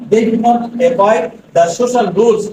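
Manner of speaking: very slow, word by word; careful dictation pace. Shown as a demonstration. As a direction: read it out slowly so they can write. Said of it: "teacher reads sentence for dictation".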